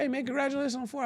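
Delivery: quick, high-pitched congratulating voice; friendly congratulation. Retold or imitated. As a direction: rattle off a retold congratulation. Friend congratulating him, retold.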